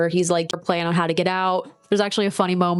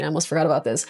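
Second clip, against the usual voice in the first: ordinary talk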